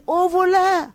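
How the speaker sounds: cri aigu et fort, bien plus haut; cri au voleur. Retold or imitated. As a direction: hurle au voleur comme une femme volée. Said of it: "Elle a crié". (crier au voleur, voix très haute et forte)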